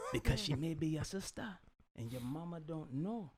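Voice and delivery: Jamaican king's voice. Retold or imitated. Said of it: "Your accent is uncanny".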